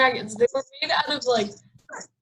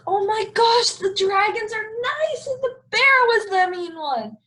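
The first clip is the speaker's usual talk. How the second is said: high, excited surprise; suddenly realizing, thrilled; amazed realization; 10 semitones higher than usual. Retold or imitated. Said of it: "And I was like".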